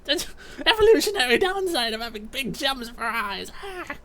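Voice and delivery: very high-pitched creature wail; panicked goblin. Acted. Blinded jailer complaining about gem eyes, panicked.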